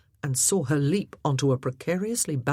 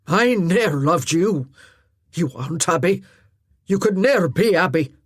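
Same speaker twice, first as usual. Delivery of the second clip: cold rejection; 6 dB louder than usual. Acted. Druston rejects Glynis.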